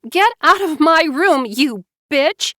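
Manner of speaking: high, loud cursing shout; 愤怒地大骂; furious shout. A narrator's reading. Joanna cursing her sister.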